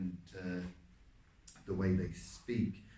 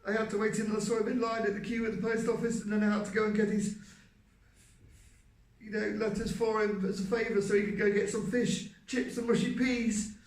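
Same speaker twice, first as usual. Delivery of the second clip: much higher, louder, as if in pain; heightened character voice; 12 semitones higher, 6 dB louder than usual. Shown as a demonstration. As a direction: push the voice high and loud, pained. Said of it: "the heightness in the voice".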